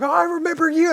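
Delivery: very high, bubbly pet voice; excited dog voice. Pretend. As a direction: squeal it like a happy dog. Voicing the happy dog.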